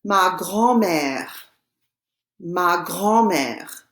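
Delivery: slow, drawn-out French demonstration; slow French model. Shown as a demonstration. Teacher models the French for students to repeat, slowly. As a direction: say it very slowly for learners. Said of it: "and I will say it".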